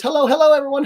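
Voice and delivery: high, loud sing-song hello; reading out a chat greeting. Retold or imitated. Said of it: "Cliff Jones says".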